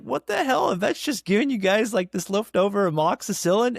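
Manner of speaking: high-pitched incredulous retelling; acted outraged disbelief. Questioning mother-in-law about the vet's handout, acted disbelief.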